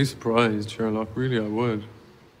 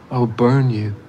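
threat; 6 dB louder than usual. Acted. Villain threatens the detective.

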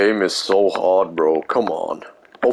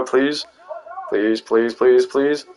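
pleading; 5 semitones higher, slower than usual. Real, not acted. Begging to leave the map.

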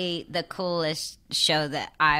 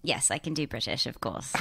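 lower, softer British accent; 4 semitones lower, 9 dB quieter than usual. Shown as a demonstration. Actress asked to do a proper Brit, British accent. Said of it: "Can you do a proper Brit?".

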